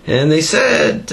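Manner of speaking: usual speaking voice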